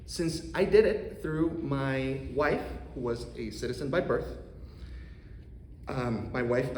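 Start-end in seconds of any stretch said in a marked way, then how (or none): none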